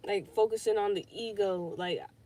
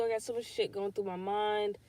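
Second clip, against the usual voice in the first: usual speaking voice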